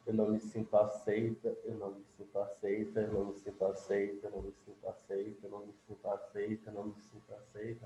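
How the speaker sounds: a soft repeated chant that fades toward a murmur; fading tapping mantra. Shown as a demonstration. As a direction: murmur it over and over as you tap. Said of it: "Tô dando um exemplo".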